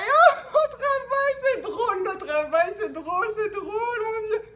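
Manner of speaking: high, loud, breaking into laughter; laughing fit. Real, not acted. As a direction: giggle through every word. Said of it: "Excusez-moi".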